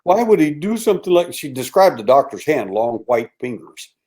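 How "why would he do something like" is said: crying. Retold or imitated. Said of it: "she cries".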